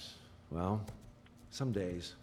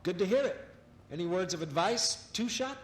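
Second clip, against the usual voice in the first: upbeat on-air announcer voice, higher and louder; cheerful broadcaster introducing a guest; radio host; 10 semitones higher, 5 dB louder than usual. Acted. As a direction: sell it like a live broadcast host.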